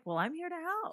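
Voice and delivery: high, sugary helpdesk voice; mock a cheerful support rep; chirpy agent. Imitating a typical Apple agent, a high chirpy greeting. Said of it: "normally they're like".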